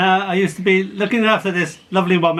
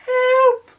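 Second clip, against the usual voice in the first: very high, loud cry for help; wailing; 4 dB louder than usual. Acted. Girl calls from inside the grave, high wail. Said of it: "And again".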